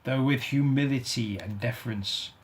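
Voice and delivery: ordinary delivery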